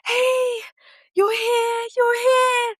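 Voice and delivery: put-on very high, tender baby talk; talking to newborn. Mother greeting her newborn son, high tender coo. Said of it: "I start speaking to him".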